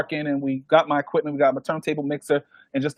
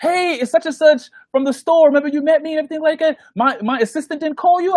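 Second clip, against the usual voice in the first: high, fast, eager voice of the store caller; bubbly rushed caller, pitched high; excited store caller; 10 semitones higher, 3 dB louder than usual. Retold or imitated. Caller from the store rushing her news on the phone, eager and high.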